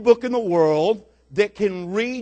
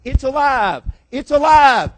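higher, mounting shouts, each a separate cry; screaming street showman; 5 semitones higher, 5 dB louder than usual. Retold or imitated. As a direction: shout it to stop traffic, building to the last. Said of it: "He's screaming".

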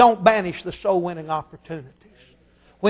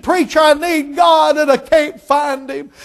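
desperate plea; 5 semitones higher, slower than usual. Retold or imitated. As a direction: beg for help. Man begging for God.

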